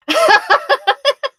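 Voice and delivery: high bright burst of laughter. Real, not acted. Laughing at her mom giving up.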